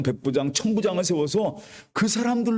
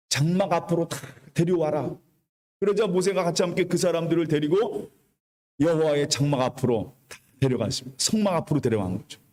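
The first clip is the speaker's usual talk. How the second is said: normal talking voice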